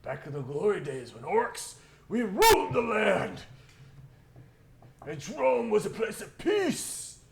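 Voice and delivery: high, very loud orc boasting, building to a roar; rousing drunken war-stories; glory-days orc. Acted. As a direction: bellow nostalgic pride to the whole tavern. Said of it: "as the orcs are there".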